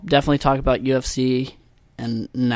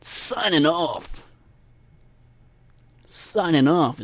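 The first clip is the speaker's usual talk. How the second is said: slow, put-on DJ voice; radio host sign-off; 4 semitones higher, much slower than usual. Podcaster mocking how radio hosts sign off, DJ voice. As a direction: draw it out like a DJ sign-off. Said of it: "they sign off".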